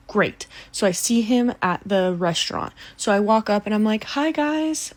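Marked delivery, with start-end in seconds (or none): 4.0-4.9 high-pitched friendly hello; cheery greeting. Retold or imitated. Magician walking up to a table of diners, cheery greeting. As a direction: lift it high, friendly and innocent.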